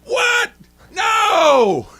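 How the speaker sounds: very high, loud cry of protest; outraged shout. Retold or imitated. Mother protesting a call on her daughter, loud shout. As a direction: scream no at the ref. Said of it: "she goes".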